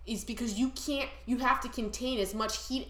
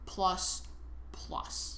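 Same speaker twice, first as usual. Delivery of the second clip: each plus softer, last one whispered; fading echo; 4 semitones lower, 9 dB quieter than usual. Pretend. Boasting, echo fades out.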